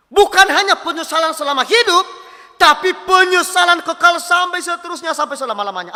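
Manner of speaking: loud, high sermon delivery; forceful preaching. Real, not acted. Preacher warning the congregation of eternal regret, forceful. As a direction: drive the warning home at full force.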